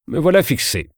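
ordinary delivery

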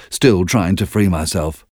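plain reading voice

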